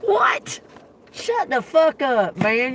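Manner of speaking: high, slow what, shut the fuck up; outraged disbelief. Real, not acted. She reacts to Jerome calling her, in high outraged disbelief. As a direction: squeal it, then drag it out.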